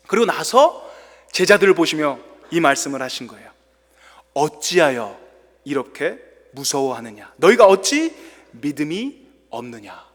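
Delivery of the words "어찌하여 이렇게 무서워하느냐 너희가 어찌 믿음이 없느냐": low, calm reproof; a hint of Jesus rebuking in a low voice; Jesus rebuking frightened disciples; gentle rebuke. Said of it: "꾸짖은 거예요".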